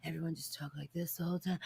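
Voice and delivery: hushed, quick, low mumble; mock the actors with a low, barely audible murmur; whisper acting. Film fan mocking how every actor talks, whisper acting. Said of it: "everyone whisper act".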